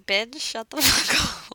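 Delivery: scolding her own recorded voice, slowed right down; slow, high, exasperated put-down; mock annoyance. Editing, telling her own voice off slowly. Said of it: "I'm like".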